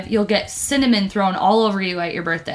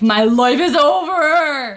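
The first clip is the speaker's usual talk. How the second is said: slow, louder, melodramatic sob; mock despair; 5 semitones higher, 4 dB louder, slower than usual. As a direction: wail it like a tragic heroine. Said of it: "like, fucking mood".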